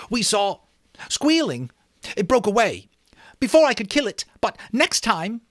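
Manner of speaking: put-on high, loud, eager boy's voice; Jack boasting. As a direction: tell it keen to go back and kill it. Jack retells the pig hunt.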